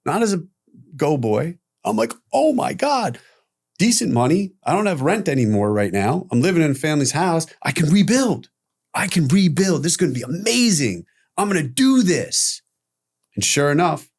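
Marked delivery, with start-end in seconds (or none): 2.3-12.6 higher-pitched excited reliving of good news; hopeful, thrilled inner voice; retold elation. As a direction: gush it as if life just turned around. Retelling his elated reaction to the job.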